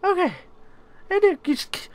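very high, squeaky panic voice; frightened squeal. Real, not acted. Player unable to turn on that light, frightened squealing. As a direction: squeak the pleas out in fear.

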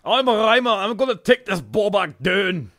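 high, loud comic boast; hammed-up fighter's retort. Answering a rival in a mock fight, hammed-up boast. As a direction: boast back at your attacker, pitched high.

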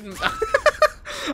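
very high giggling laugh; real laugh. Real, not acted. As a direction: burst into a high-pitched laugh. Laughs at blocked player.

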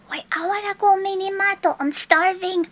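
normal talking voice

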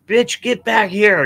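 mock yell at a runaway dog; high yelled command; retold yell. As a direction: call the dog back sharply. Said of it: "I'm yelling at her".

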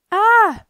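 loud, high choked "Ah!"; sudden half-strangled yelp; strangled gurgling cry. A narrator's reading. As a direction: cry out as if being throttled. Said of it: "Marcellus gurgled, half strangled".

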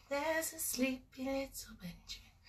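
soft lullaby whisper; whispering over a crib. Shown as a demonstration. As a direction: breathe it like a sleeping baby is near. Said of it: "snuggling up to me".

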